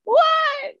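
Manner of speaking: very high mock cry of bewilderment; imitated shouted "what?". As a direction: squeal it like someone calling back confused. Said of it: "and I'm yelling, and Olivia's like".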